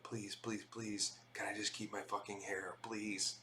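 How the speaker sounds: hushed, half-voiced pleading; whispered prayer. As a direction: whisper a desperate little prayer. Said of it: "I would just pl-, pray. I'd be like".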